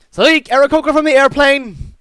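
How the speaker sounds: high, loud announcing shout. Acted. Modron calling up the next case, shouting. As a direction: call it out across a crowded hall. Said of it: "and then he shouts".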